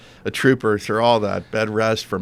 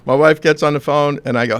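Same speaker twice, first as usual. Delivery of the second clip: usual speaking voice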